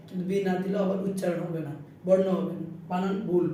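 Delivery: ordinary talk, nothing marked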